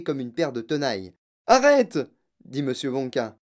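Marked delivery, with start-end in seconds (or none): 1.5-2.0 loud, high cry to stop; urgent command. Acted. Candy maker stopping girl from chewing gum, urgent shout. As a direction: call out sharply to halt someone.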